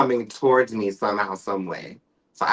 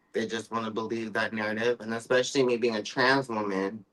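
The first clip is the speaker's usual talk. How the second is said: usual speaking voice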